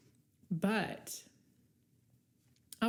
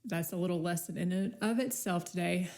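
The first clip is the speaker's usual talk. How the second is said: normal talking voice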